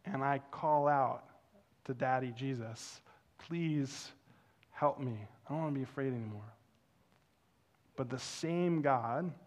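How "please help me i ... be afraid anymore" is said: softer, pleading prayer; quiet plea. Retold or imitated. Pastor praying to Daddy Jesus, soft pleading. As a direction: plead it softly, rushing the end.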